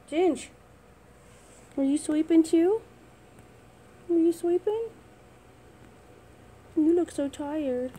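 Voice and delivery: calling out. Real, not acted. Calling drowsy dog.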